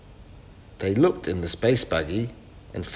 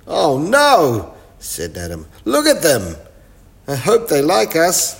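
nervous; 7 semitones higher, 7 dB louder than usual. Acted. Boys see strange creatures.

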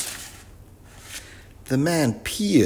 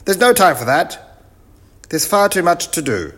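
loud, high commands; bossy professor; 10 semitones higher, 9 dB louder than usual. Acted. Professor orders the children to work, loud and high. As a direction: chivvy the crew in a high, loud voice. Professor rebukes supposed crew.

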